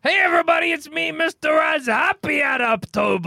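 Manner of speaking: high, shouted host voice; announcer character voice. Pretend. S1 enters as Mister Oz character.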